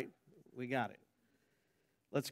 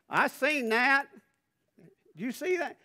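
put-on playful Southern Arkansas accent, higher pitched; 12 semitones higher, 7 dB louder than usual. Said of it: "your Southern Arkansas accent is coming back".

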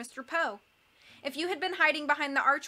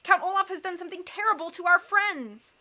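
crying out; 6 semitones higher, 5 dB louder than usual. A narrator's reading. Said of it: "he cried".

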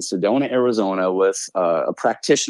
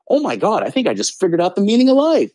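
much higher, awestruck and a little louder; leaving the ceremony, awestruck and much higher; amazed realization; 11 semitones higher, 3 dB louder than usual. Retold or imitated. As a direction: gush like you just cracked the universe.

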